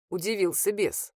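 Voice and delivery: plain reading voice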